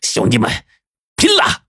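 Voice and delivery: steel the gang for a last stand; gritted teeth. A narrator's reading. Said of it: "就一咬牙说".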